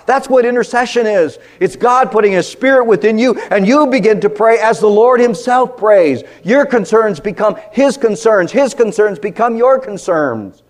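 loud, high impassioned preaching; rising pulpit fervour; full-force preaching. Acted.

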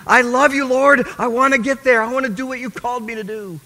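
high, loud fervent prayer; fervent cry. Retold or imitated. Young man crying out his zeal to the Lord. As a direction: pour it out with full fervour.

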